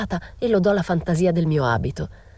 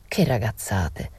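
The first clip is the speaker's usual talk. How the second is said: put-on slow, lower scoffing old woman; scornful old woman; 6 semitones lower, slower than usual. Gossiping old woman dismissing the rumour, slow scoff.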